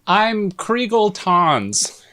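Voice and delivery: drawn-out introduction. Pretend. Made-up joke name in introductions.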